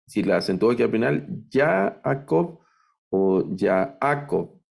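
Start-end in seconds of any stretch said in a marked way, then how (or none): 1.5-4.6 Jacob slowly stressed on YA-, then on middle -A-; wrong stress. Shown as a demonstration. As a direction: misplace the stress in Jacob: YA-akov, ya-A-kov. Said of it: "No es Jacob o Jacob". (Jacob mal acentuado, al principio y en medio)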